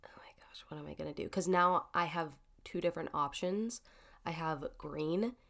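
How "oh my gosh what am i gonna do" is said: panicked whisper. Retold or imitated. Panicked quote of herself.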